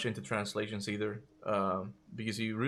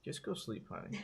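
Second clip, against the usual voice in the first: whispered; 2 semitones lower, 10 dB quieter than usual. Real, not acted. Telling partner to go sleep.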